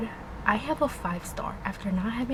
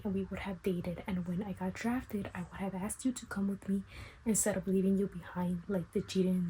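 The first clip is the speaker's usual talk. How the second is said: hushed, half whispered retelling; hushed voice, hiding in a cousin's room at a party; hushed reading; 7 dB quieter than usual. Real, not acted. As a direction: keep it down so the party doesn't hear. I'm at a party, literally in my little cousin's room.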